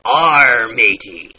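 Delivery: loud growled "R" then "matey"; classic pirate R. Shown as a demonstration. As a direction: bark out a pirate's R. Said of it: "the classic".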